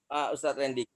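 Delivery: ordinary delivery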